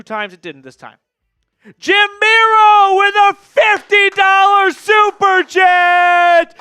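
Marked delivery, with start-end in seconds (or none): 1.8-6.6 slow, drawn-out arena announcer shout, high and loud; hype shout. Real, not acted. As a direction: boom it out like a ring announcer. Announcing a super chat.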